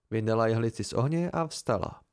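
ordinary delivery, nothing marked

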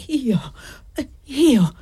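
a broken, breathy disbelieving whisper; can't believe he's accused; wounded whisper of shock; stunned disbelief. Acted. Old man stung by accusation.

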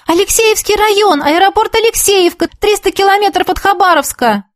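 high-pitched, calling out details over the phone; urgent reporting. Acted. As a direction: report anxiously across a long distance. Reporting location over bad line.

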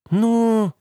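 long, high-held hesitant sound; drawn-out. A narrator's reading. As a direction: stretch it out on a high note. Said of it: "протянула она".

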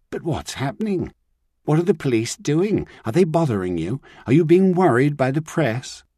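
fretful caller, rising and pressing; anxious Jennifer. Acted. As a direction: fire off the worried questions. Jennifer's anxious phone questions.

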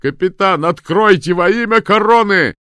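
very high, very loud command; desperate official bellow over a gale; shouted demand. A narrator's reading. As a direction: roar for the door to be opened. Demanding entry over wind.

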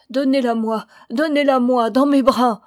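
plead, building to a cry; mother begging for her dead child; desperate pleading. A narrator's reading. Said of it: "implorait Ramona".

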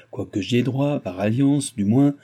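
ordinary delivery, nothing marked

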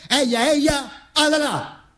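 high mock rally chant; fascist battle cry. Narrator mocking a fascist rally cry.

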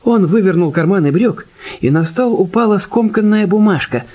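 ordinary delivery

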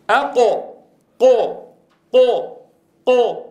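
loud high قو thrust upward; teacher drilling qaf again; qaf demo. Shown as a demonstration. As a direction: hit قو hard and heavy. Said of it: "كما ننطق فنقول".

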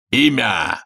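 barked interrogator's demand; Гекса Ируканский. A narrator's reading. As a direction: demand it like a feared inquisitor. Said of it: "рявкнул он голосом Гексы и Руканского".

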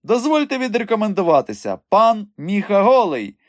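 high, grand self-introduction; showy, high-pitched formality; theatrical. A narrator's reading. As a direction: present yourself with a flourish, slowing on the name. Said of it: "Він театрально схилив голову".

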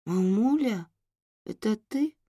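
put-on high little girl voice; a drowsy child calling mom; sleepy child voice. Sleepy child wakes, calls mother. (сонный детский голос)

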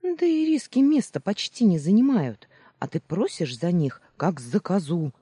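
loud, high snapped-back retort; growling retort. A narrator's reading. Heroine haggles back at Барри over percentages, mock growl. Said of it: "рыкнула в ответ".

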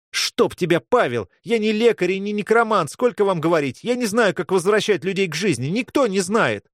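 high-pitched, agitated protest; exasperated outburst. Acted. Mage refusing a boy's plea, exasperated outburst. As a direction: snap back, fed up with being asked.

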